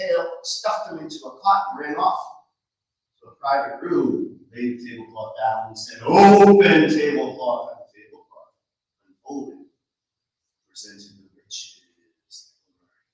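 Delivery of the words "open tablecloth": command. Acted. Magic command.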